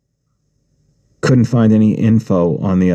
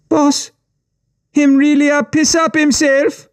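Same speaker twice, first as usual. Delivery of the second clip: much higher, put-on Caribbean-tinged thug voice; jeering gang member. A narrator's reading. Gang member mocking the boy to the boss, high and jeering. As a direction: crow it out, delighted at his shame.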